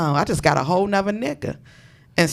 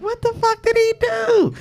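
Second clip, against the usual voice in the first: incredulous question; 13 semitones higher than usual. Retold or imitated. Wondering what friend did.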